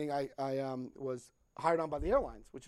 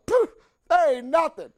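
high, loud, slow brush-off; dismissive Jersey guy; 10 semitones higher, 12 dB louder than usual. Retold or imitated. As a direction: wave it away like it's nothing. Sponsor from Hoboken shrugging.

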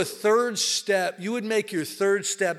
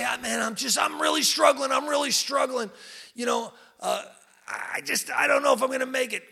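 high, strained pleading cry; a struggling newcomer begging for help; desperate newcomer; 8 semitones higher than usual. Retold or imitated. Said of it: "you go up to a newcomer and you say".

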